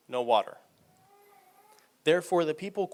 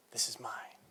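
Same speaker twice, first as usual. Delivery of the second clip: hushed whispered aside; 10 dB quieter than usual. Acted. Devil gloating over his kingdoms, whispered. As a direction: whisper it like a secret boast.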